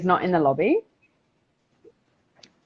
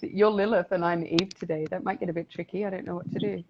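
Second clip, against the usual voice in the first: normal talking voice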